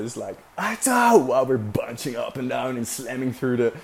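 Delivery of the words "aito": high, loud call across the deck; yelling a name. Retold or imitated. Calling to Aito over the storm, loud yell. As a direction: shout his name over the wind. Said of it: "I scream at Aito".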